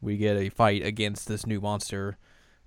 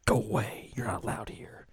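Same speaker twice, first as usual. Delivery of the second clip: hushed, breathy whispered warning; sick girl whispering; 5 dB quieter than usual. Retold or imitated. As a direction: whisper to keep her away. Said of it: "And the girl's like".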